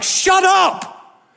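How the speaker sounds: high, loud preaching cry; passionate shout. Real, not acted. Pastor crying out to his church in frustration, loud and high. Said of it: "That's why I lost my mind".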